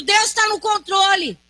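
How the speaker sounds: shouted climax of the litany; preacher's shout. Acted. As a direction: cry it out at the peak. Climax of repeated refrain.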